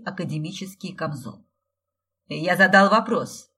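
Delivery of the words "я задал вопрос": loud, stern growl; angry bark. A narrator's reading. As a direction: bark it out angrily. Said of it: "рыкнул пришедший".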